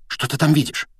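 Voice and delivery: high, eager hushed question; keen, excited half-whisper. A narrator's reading. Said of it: "возбуждённо прошептала Гермиона".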